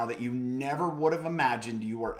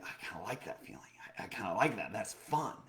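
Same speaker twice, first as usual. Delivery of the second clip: soft inner thought; 3 semitones lower, 7 dB quieter than usual. Retold or imitated.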